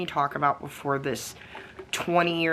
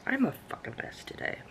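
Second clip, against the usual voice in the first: whispered aside; 6 semitones higher, 9 dB quieter, faster than usual. Real, not acted. Self-deprecating aside after itchy nose.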